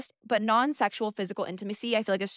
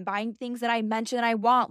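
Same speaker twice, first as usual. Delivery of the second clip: ordinary delivery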